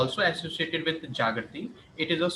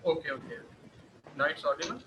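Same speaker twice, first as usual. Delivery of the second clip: barely audible; 5 dB quieter, faster than usual. Real, not acted. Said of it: "No, it's not audible yet".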